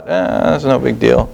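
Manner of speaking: slow, offhand voice brushing it aside; dismissive shrug. Pretend. Preacher voicing a careless believer's excuse, offhand and slow. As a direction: shrug it off lazily, like it doesn't matter. Imagined excuse for skipping commitment.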